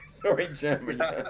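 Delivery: sorry said through a laugh; laughing apology. Real, not acted. Apologising to the guest while laughing. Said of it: "laughing apology".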